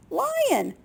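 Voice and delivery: high-pitched call at normal volume; quick high bird call; bird chirp. A narrator's reading. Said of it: "the bird chirped, making a face".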